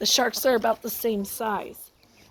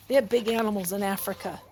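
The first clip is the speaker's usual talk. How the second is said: ordinary talk, nothing marked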